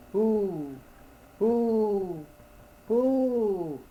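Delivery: high, drawn-out owl hoot. A narrator's reading. Owl answering the lost man, high hooting. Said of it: "I heard an answer".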